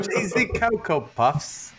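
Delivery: slow, high mock English accent. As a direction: echo it in a drawn-out English accent, amused. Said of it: "in an English accent?".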